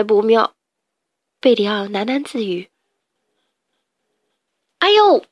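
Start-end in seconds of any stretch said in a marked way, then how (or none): 4.8-5.2 sharp high yelp; startled ouch; yelp of pain. Acted. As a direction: cry out as if struck. Berio hit by trident.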